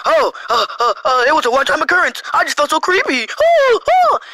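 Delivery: hammed-up mock distress, climbing high; mocking imitation. Mocking the accuser's contradictory tweet, exaggerated fake distress. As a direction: mimic the accuser, ending in high fake wails.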